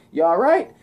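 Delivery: lightly acted hero voice; Spider-Man. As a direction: call out a quick check. Voices Spider-Man checking on officer.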